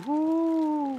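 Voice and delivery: high, long drawn-out ooh; sustained high-pitched "ooh"; intrigued ooh. Real, not acted. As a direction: coo with interest on one high held note. Reacts to the revealed plant.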